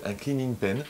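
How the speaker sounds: English term with a French accent; accent français. Real, not acted. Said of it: "excusez-moi pour mon accent anglais". (accent français assumé sur l'anglais)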